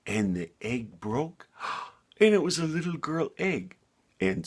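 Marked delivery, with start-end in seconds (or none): 1.6-3.8 delighted gasp. A narrator's reading. Egg hatching, delighted reveal.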